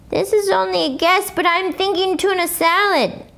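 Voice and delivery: a hint of a thoughtful kid; voiced boy. A narrator's reading. Jose guesses, voiced higher.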